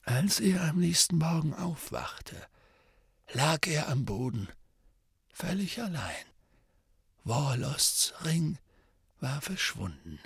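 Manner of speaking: soft, lightly voiced old mentor; old wizard voice. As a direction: tell it gravely and quietly. Dumbledore's voice.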